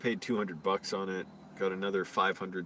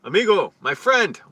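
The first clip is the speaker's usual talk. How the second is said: mock friendly greeting, loud and much higher; calling out amigo; 9 semitones higher, 11 dB louder than usual. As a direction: hail him like an eager stranger in the park. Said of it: "I hear".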